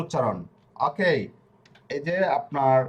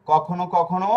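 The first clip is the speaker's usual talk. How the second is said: usual speaking voice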